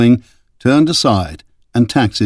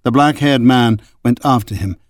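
normal talking voice

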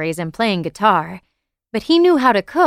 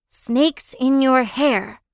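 slow spooky spell chant; witch spell casting; 5 semitones higher, much slower than usual. A narrator's reading. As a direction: cast it like a movie witch, slowly. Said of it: "the way witches cast spells in movies".

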